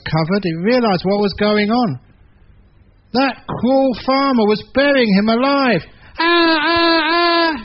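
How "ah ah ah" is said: very high, crying mock bray, louder; panicked braying at being buried; louder donkey bray. Donkey braying louder as the farmer buries him alive. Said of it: "Even louder, said the donkey".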